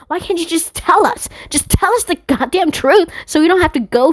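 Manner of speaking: high, strained ranting, half-whispered; mock angry rant. Venting at the system about hidden truth, high frustrated rant. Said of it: "Like, I get so mad".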